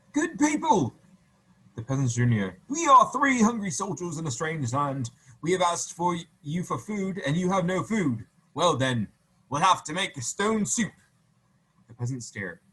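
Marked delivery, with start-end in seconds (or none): 0.1-0.9 high, loud call to the crowd. Acted. Soldier calls out to village. 2.7-10.9 higher, louder proclamation to the crowd; soldier announcing. Acted. Soldier addresses the gathered peasants.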